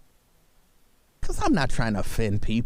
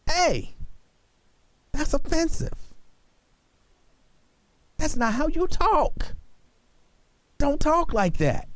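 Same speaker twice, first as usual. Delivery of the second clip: much higher, drawn-out protest; mock scolding; 12 semitones higher than usual. Host acting out what he'd tell a few people, mock scolding. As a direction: scold in a high voice, stretching the words.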